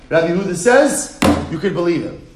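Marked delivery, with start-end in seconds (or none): none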